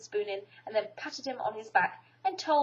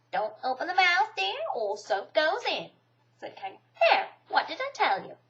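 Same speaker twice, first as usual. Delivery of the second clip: Kanga's voice; 7 semitones higher, 4 dB louder than usual. A narrator's reading. Kanga voiced in read story.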